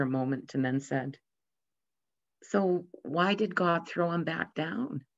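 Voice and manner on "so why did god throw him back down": put-on puzzled child. Boy asks father about seagull.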